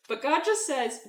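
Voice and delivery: usual speaking voice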